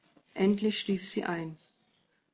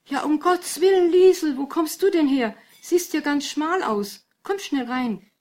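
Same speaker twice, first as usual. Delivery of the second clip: high, loud startled exclamation; startled mother; 8 semitones higher, 8 dB louder than usual. A narrator's reading. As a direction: voice a mother's astonished welcome, pitched high. Mutter empfängt unerwartet die Tochter. (erschrocken überraschte Mutter, hoch und laut)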